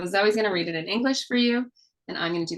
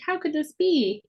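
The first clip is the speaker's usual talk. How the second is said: high questioning singsong; asking question; 9 semitones higher, slower than usual. Shown as a demonstration. Mother demonstrating the question action for kids, high and wondering. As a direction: lift it up as a puzzled question. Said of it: "That's where we ask a question".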